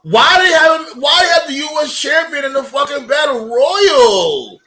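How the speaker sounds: outraged shout at the screen; angry. Real, not acted. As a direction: yell it in disbelief. Outraged at booking.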